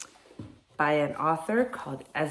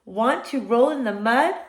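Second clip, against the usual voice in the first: higher, louder pig making an invitation; pig character; 6 semitones higher, 6 dB louder than usual. A narrator's reading. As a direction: pitch it up as the pig. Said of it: "said the pig".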